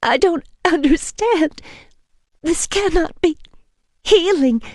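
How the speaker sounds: high, tearful disbelief; bewildered through tears; weeping. A narrator's reading. Woman weeps to vampire, tearful. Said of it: "I said, weeping".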